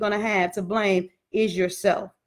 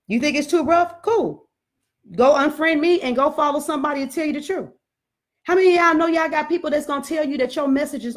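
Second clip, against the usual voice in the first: raised, fired-up sermon voice, higher and driving; preaching; 4 semitones higher than usual. Acted. Preacher rallying her live followers, preaching at full force. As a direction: preach it hard to the congregation of viewers.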